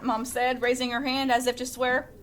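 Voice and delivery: plain reading voice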